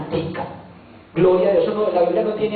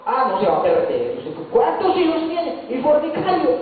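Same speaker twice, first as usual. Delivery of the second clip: higher, mock condemning questions; judgmental scolding; 7 semitones higher than usual. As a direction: lecture him like a stern judge. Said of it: "predicador juzgando a un extraño". (regaño acusador, más agudo)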